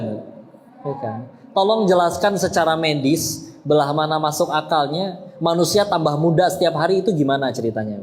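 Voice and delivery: usual speaking voice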